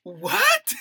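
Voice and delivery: loud, very high disbelieving cry; incredulous. Real, not acted. Podcaster reacting to the quote about culture, incredulous. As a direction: shoot the pitch right up in disbelief.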